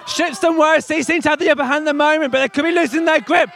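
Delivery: animated commentary rising to shouts; live play-by-play. Real, not acted. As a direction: call each pull as if it were a final. Live tug of war commentary.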